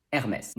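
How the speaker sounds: Hermès as "air-MESS" with silent H; French pronunciation. Shown as a demonstration. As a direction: say Hermès with throaty R, sounded S. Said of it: "we know it's pronounced".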